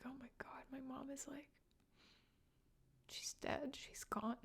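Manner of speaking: high, hushed, barely voiced gasp of shock; stunned disbelief. Daughter realizing her mother just died, stunned hushed whisper. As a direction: whisper it as the truth sinks in. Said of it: "and just be like".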